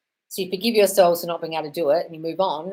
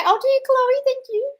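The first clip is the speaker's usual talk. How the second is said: gushing thanks; 5 dB louder, slower than usual. Real, not acted.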